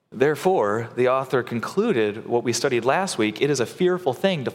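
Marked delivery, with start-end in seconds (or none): none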